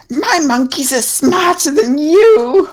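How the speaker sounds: high, slow gloating hag; old hag. Acted. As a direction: taunt like a smug witch. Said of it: "she says".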